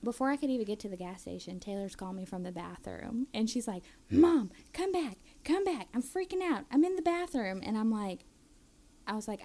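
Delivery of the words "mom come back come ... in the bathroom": high, loud frantic plea; frantic kid. Retold or imitated. Daughter calling mom from the bathroom, high frantic. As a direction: beg mom to come back in a panic.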